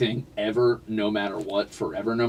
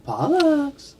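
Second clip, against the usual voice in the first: much higher coaxing call; cat-calling voice; 14 semitones higher than usual. Real, not acted. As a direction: sing his name up high to lure him.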